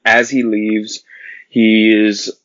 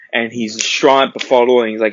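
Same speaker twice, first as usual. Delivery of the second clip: normal talking voice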